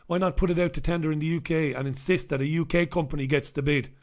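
usual speaking voice

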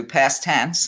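ordinary delivery

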